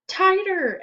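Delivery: high loud chant of a yell; class yelling. Acted. The whole class urging Stephen on, yelling. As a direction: shout it together. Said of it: "everyone yelled".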